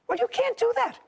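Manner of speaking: high, sharp rebuke; scolding judge. Real, not acted. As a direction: put her firmly in her place.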